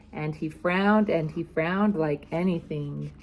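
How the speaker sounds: usual speaking voice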